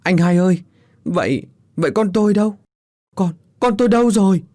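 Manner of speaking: high, loud, broken wail; a father crying out for his lost son; wailing in distress. Acted. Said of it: "mếu máo". (ông Tứ mếu máo hỏi con mình đâu, giọng cao và to)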